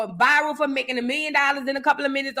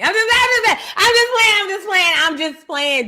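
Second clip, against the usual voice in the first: high, loud laughing backpedal, fading down; excited protest; 9 semitones higher, 4 dB louder than usual. Real, not acted. Insisting to Tretch she's only joking, high excited protest. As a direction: yelp it out, then settle. Backpedalling after joke about husband.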